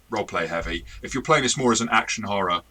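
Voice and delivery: usual speaking voice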